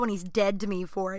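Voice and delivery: ordinary talk, nothing marked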